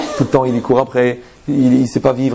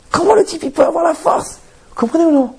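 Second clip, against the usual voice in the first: much higher, amazed disbelief; incredulous high outburst; 14 semitones higher than usual. Real, not acted. Rabbi asking the crowd, voice shooting up in disbelief. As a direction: throw the question up high in disbelief. Incredulous rhetorical questions to audience.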